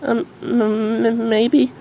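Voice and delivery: high, stammering flustered mare; blushing, can't get it out; bashful. Acted. Derpy flustered by Dinky's question. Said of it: "Derpy was blushing uncontrollably".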